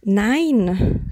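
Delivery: drawn-out, hesitant yes, then no; mildly flustered, words held and set apart; awkward stammer. A narrator's reading. Narrator answers the stranger unsure of herself, awkward mild stammer. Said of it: "stammelte ich unbeholfen".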